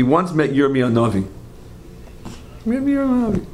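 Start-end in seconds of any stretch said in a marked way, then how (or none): 2.6-3.5 high-pitched astonished echo of the name; amazed repeat. Real, not acted. Speaker marvels to listeners at whom Plato met, amazed. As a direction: repeat it, marvelling, much higher.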